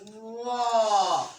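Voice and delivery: heavy full-mouthed whoa; full-mouth tha. Shown as a demonstration. Teacher shows the class the full-mouth letter, heavy dark whoa. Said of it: "You see the difference?".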